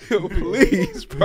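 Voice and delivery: high, loud begging through laughter; laughing plea. Real, not acted. Host pleading with friend to be normal, laughing. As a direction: plead while cracking up.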